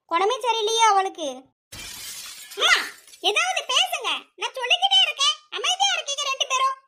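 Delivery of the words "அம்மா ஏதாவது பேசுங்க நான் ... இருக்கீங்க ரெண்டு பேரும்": pitched well up, pleading and frustrated; exasperated plea. Acted. Daughter pressing silent parents to respond, high exasperated plea. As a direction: demand an answer, voice climbing.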